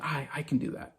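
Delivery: soft imitation of a film line; quietly voiced Gimli quote. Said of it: "And Gimli saying".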